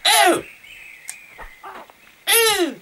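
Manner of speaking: short high-pitched yelp; high effort cry. Real, not acted. Gamer attacking an enemy, high effort cry. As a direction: let out a high "ew" with each throw. Effort noises throwing in game.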